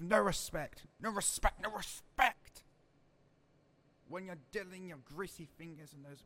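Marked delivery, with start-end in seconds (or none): 0.0-2.6 angry slow emphasis. Acted. Leader ranting.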